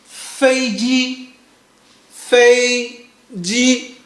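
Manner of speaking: "Fei ji" on a high level first tone; 飞机，平平的第一声; Chinese fei ji. Shown as a demonstration. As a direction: keep "Fei ji" high and flat. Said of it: "We say".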